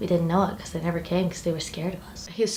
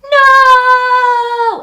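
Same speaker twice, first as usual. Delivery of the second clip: much higher, louder hammed-up scream of no; over-the-top screamed no; bad movie scream; 16 dB louder than usual. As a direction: scream it like a cheesy actor. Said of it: "a really bad, like, scream".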